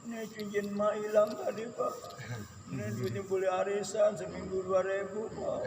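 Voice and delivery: a hint of a tearful wife pleading; whiny wife. Wife complaining to husband about lost ring, lightly whiny. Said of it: "nangis, bilang sama suaminya".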